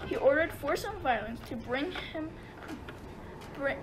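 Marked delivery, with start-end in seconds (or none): none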